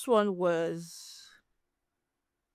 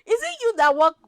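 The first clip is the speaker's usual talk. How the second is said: loud, much higher incredulous challenge; indignant retort; 11 semitones higher, 7 dB louder than usual. Retold or imitated. Vlogger retells scolding a demanding beggar, loud and high. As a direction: fire it back in outrage, pitched up and loud.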